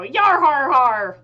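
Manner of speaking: loud, high, drawn-out pirate guffaw; whole crew's slow, booming cackle; pirate laughter. Acted. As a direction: roar a hearty mock pirate laugh. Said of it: "they all laugh and go".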